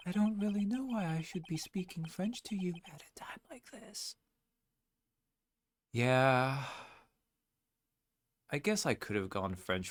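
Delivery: high unvoiced whisper; whispered aside. Husband whispering an aside, high whisper. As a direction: whisper it so she can't hear.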